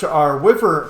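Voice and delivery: ordinary talk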